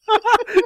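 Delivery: high squealing laugh; laughing fit. Real, not acted. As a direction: laugh helplessly, near tears.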